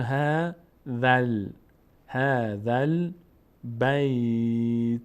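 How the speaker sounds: slow syllables with the dhal tied into the lam in ذل; measured Quranic demonstration of ها ذل بیت; joined recitation demo. Shown as a demonstration. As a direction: draw out ها, join ذل, stretch بیت. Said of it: "دیگه زال مستقیم میچسبه به لام".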